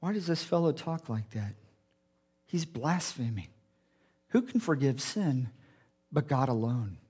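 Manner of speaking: low, soft muttering thoughts; inner thoughts. Retold or imitated. Teachers of the law thinking to themselves, low and disapproving. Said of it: "sitting there thinking to themselves".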